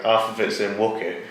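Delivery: ordinary talk, nothing marked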